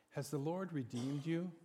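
usual speaking voice

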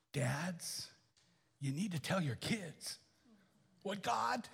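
soft unvoiced whisper to fathers; whispered appeal. Real, not acted. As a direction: lean in and whisper it.

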